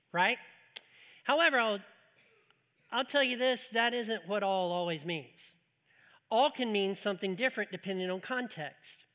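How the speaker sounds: ordinary talk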